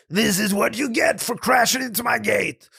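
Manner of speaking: angry threat. Acted. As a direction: bark it at the man who crashed your gate. Landowner threatens wagon driver.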